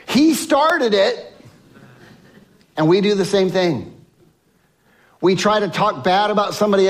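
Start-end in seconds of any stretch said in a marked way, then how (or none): 0.1-1.3 high, slow playground whine; mock a kid blaming another; whiny child. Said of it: "What do children do when they get in trouble".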